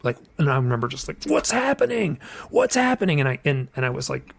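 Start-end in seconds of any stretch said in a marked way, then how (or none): 1.2-3.2 high, urgent panicked questioning; pitched-up cry of mild panic; retold panic. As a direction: reenact alarmed, high-pitched asking. Retells panicked questions that night.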